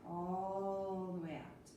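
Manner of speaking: slow, sighing out all the air; dramatic sigh. Shown as a demonstration. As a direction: sigh it out slowly. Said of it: "Exhale through the mouth with a big sigh".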